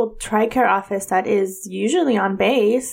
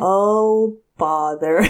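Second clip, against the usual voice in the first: Eeyore; much slower than usual. Retold or imitated. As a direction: sigh it out, lightly voiced glum donkey. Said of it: "Fucking Eeyore on the phone".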